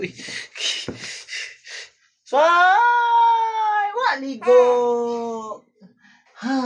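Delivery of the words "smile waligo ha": excited shout. Real, not acted. Father shouts playfully to get his baby to smile, high and loud.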